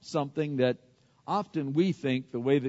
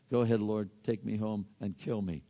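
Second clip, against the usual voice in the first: low, subdued mock self-pity; mildly dejected plea; 6 semitones lower, 6 dB quieter than usual. Elijah asks the Lord to take him home, mildly dejected. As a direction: give up quietly, sinking lower. Despairing prophet's prayer, pity party.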